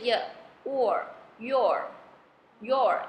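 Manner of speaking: "ye", then "were", then blended slowly into "your"; sounding out "your". Shown as a demonstration. Teacher blending ye and OUR for learners, slow sounding out. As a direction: build "your" piece by piece, slow with gaps. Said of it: "O U R".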